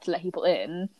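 ordinary talk, nothing marked

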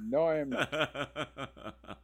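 slow, laughing no; half-laughing disbelief at the coach; laughing refusal. Retold or imitated. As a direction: laugh it off, drawn out and amused. Coach sets a huge goal.

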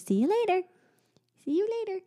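put-on high little-girl farewell; sing-song it like a toddler. Mom imitating daughter saying goodbye. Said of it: "She says".

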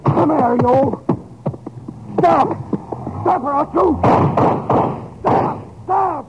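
high, frantic shouts in a struggle; frantic shouting. Acted. Storekeeper yelling at the robber during the holdup, frantic shouts. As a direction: yell at him to hand over the gun.

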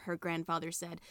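ordinary delivery, nothing marked

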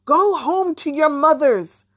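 high, loud yell across the water; the witch yells at the children to go home. Acted. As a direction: yell it across the river to drive them off. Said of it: "Then she yelled across her".

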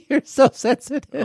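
high laughing voice, teasing; laughing tease. Real, not acted. Host laughing at his sensitive guest. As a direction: laugh it out while mocking him.

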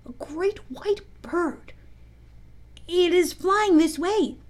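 slow, high wonder; awestruck. A narrator's reading. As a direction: draw it out in awe.

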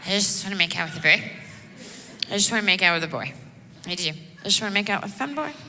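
lightly voiced slurring drunk girl; a hint of a sloppy drunk girl at the bar; lightly played drunk girl whining to a friend. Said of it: "she's gonna be drunk, face on sideways".

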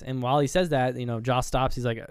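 normal talking voice

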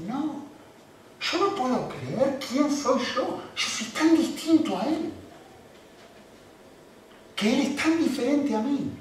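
a prophet who cannot believe what he sees; awed Isaiah. Acted. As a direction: speak as Isaiah, stunned before God. Preacher voices awed Isaiah.

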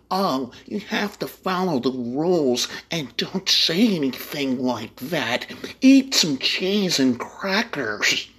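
high, slow, prim mocking voice; prissy mock voice. Mocking rule-followers in a prissy voice. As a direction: whine it out like a goody-goody.

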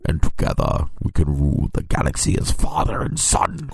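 low, breathy Vader imitation; Darth Vader impression. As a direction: rasp it low like a masked Sith lord. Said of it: "he's like a more desperate Darth Vader".

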